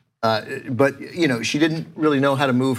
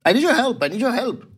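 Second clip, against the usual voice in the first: German neighbor quote; 12 semitones higher than usual. Retold or imitated. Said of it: "She's German".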